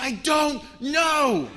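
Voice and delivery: high, drawn-out shout; very loud, helpless wail; panicked scream. Retold or imitated. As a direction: scream it slowly, each word stretched. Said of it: "when you hear someone screaming".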